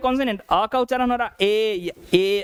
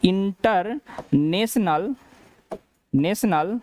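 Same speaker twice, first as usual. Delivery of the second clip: International stretched out slowly, syllable by syllable; teacher slowly modelling international; slow, drawn out; much slower than usual. Shown as a demonstration. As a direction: drag international out with a rise at the end. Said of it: "क्या कहा जाता है".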